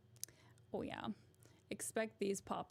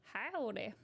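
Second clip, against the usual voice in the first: broad Texan drawl; Texas accent. Shown as a demonstration. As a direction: greet with a Texas twang. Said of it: "Do a Texas accent".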